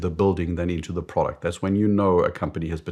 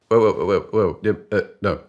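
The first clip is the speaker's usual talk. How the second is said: loud repeated interruption; mock protest; 2 semitones higher, 7 dB louder than usual. Colleague stopping boss over costs, flustered mock protest. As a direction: break in to halt the pitch. Colleague halts boss's spending pitch.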